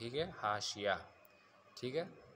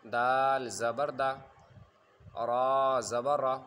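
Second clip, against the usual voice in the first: louder, slow chant with long-held دال and را; spelling recitation; 6 dB louder, much slower than usual. Shown as a demonstration. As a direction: stretch each letter name like a Qaida teacher. Teacher demonstrates spelling recitation.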